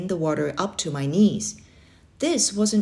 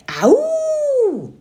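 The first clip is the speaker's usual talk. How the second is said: very high, loud yell; 6 dB louder than usual. A narrator's reading. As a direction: shout it out freely. Yelling game at the lake.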